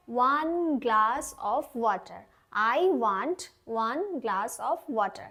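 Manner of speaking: very slow, word by word; slow model sentence. Shown as a demonstration. Teacher modelling an English sentence very slowly. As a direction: lay each word out for the class to copy. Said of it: "প্রথমে বললাম want মানে চাওয়া".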